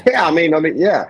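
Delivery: real laughter breaking into the words; laughing. Real, not acted. Laughing in agreement at liquor store joke.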